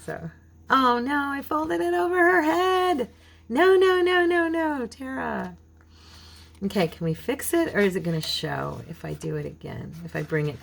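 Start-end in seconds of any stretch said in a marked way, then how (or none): 0.7-5.6 high, loud dismayed cry at her own mistake; dismayed wail. Real, not acted. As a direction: shriek no no no at the ruined fold. Mistake folding over her head.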